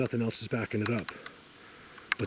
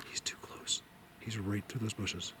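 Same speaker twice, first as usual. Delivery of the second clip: hushed; 11 dB quieter than usual. Real, not acted. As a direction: keep it down so the deer won't hear. Deer close by, keeps voice down.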